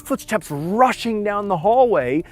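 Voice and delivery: high, driving, emphatic narration; excited climax. A narrator's reading. Narrator tells of spirits rushing out of the castle, excited.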